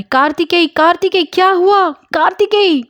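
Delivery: high, loud desperate cries of a name; panicked shouting. Acted. Murthy screams over his shot friend, high and loud. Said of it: "चिल्लाने लग जाता है".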